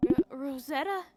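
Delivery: normal talking voice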